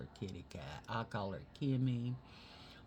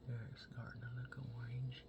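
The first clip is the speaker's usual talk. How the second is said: whispered makeup commentary; 9 dB quieter than usual. Real, not acted. Whispers while blending makeup.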